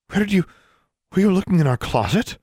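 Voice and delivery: high-pitched, indignant mother; alarmed accusation. A narrator's reading. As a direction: demand it in rising shock. Mother confronts daughter over card.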